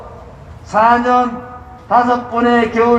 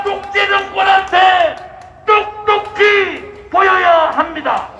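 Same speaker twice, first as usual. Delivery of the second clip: roaring rallying speech; 5 semitones higher than usual. Acted. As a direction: rouse the crowd like a rally leader. (군중을 선동하는 우렁찬 연설)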